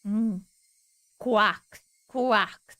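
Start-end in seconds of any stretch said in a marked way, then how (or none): 1.2-2.8 a plain, proper duck call; duck quack. Shown as a demonstration. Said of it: "That's how they sound".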